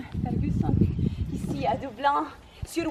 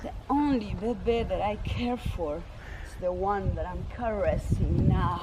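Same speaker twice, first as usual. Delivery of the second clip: slow, lower English lover's voice; seductive William; 5 semitones lower than usual. Acted. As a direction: purr it to her slowly.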